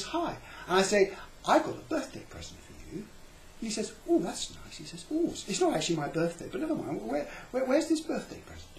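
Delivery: high, chirpy little voice; mock-cheerful little stranger; flatlander voice. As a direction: voice the tiny flat man, pleased and puzzled. Voicing the flatlander on phone.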